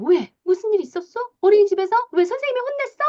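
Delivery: high-pitched, alarmed fussing; over-empathizing parent. Acted. Lawyer-type parent asks child about kindergarten, over-empathizing and alarmed. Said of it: "변호사형은 공감을 너무 잘해요".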